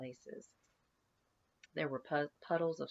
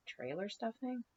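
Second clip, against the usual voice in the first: pitched-up puzzled echo; a mildly baffled echo; incredulous; 8 semitones higher than usual. Acted. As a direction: repeat it as if it makes no sense. Mammers baffled by accusation.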